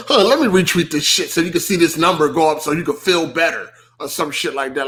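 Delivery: normal talking voice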